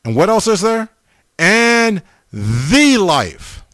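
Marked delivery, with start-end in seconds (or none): 1.4-3.6 very slow, "the" stretched high as "thee"; stress on THE. Shown as a demonstration. Preacher completing Jesus' words, stressing "the" as a long "thee". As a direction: hold "And", then swoop up long on "the". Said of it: "look at the emphasis on the word".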